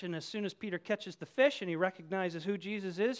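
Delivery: usual speaking voice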